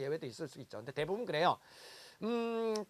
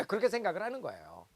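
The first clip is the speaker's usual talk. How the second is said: ordinary talk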